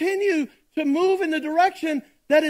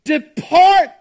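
forceful preaching; 6 dB louder than usual. Acted. Pastor reading scripture to the congregation, in a loud preaching voice. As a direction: proclaim each phrase to the whole room.

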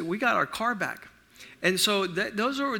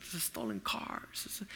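whispered prayer; 3 semitones lower, 13 dB quieter than usual. Retold or imitated. Dad praying softly in car.

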